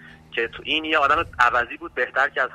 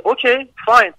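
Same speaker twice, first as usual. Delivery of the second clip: retorting okay fine; 10 semitones higher, 8 dB louder, slower than usual. Retold or imitated. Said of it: "بعد منم بهش گفتم که".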